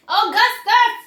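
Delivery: high, loud shout. Acted. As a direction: shout the name in alarm. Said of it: "shouted Missus Gloop".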